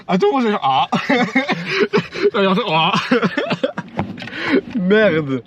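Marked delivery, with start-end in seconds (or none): 0.9-2.6 high laugh. Real, not acted. Friend's car joke. 3.0-4.7 high laugh. Real, not acted. Laughing at own joke.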